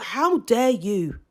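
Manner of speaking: slow, quiet cold indignation; slow indignant. Retold or imitated. Recalling her thought about the boyfriend, slow quiet indignation. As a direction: let it land slowly, offended. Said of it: "I thought".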